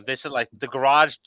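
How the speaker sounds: normal talking voice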